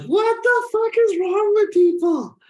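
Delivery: high, loud, slow exasperated cry; outraged yell. Retold or imitated. Reacting to sappy comments, slow outraged yell. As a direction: howl it at the comments. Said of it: "I'm like".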